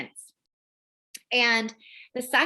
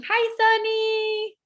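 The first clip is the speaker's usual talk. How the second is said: much higher, very slow and sweet; 12 semitones higher, 3 dB louder, much slower than usual. Real, not acted. Greeting a baby on the call.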